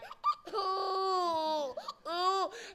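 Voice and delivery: high, drawn-out mock baby wail; baby crying. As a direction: keep bawling like a baby. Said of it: "I just kept crying".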